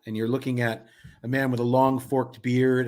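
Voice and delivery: ordinary talk, nothing marked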